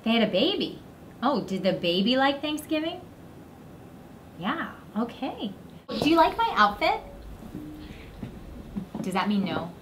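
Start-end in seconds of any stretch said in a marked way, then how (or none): none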